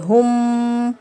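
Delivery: हुम with a held nasal meem; ghunna on meem. Shown as a demonstration. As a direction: hum the मीम in हुम. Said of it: "अभी हम इस मीम को पढ़ रहे हैं". (हुम को गुन्ने के साथ)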